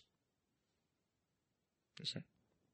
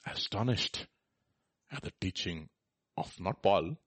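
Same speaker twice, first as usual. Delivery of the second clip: ordinary delivery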